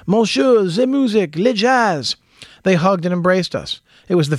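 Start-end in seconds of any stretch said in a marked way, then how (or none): 0.1-2.2 put-on higher, light French accent on the greeting. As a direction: playfully imitate a Parisian saying it in French accent. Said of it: "tries in a French accent".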